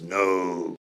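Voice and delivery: a faint hint of a Bristolian accent on a single no. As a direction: imitate the late wife's favourite no, barely Bristolian. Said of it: "In a Bristolian accent".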